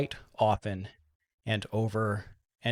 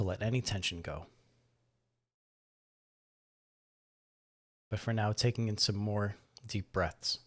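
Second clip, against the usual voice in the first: calm, measured voice with long pauses; guided meditation; 4 dB quieter than usual. Acted. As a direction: gently guide listeners through breath and body, softening as they settle. Leads a guided breathing meditation.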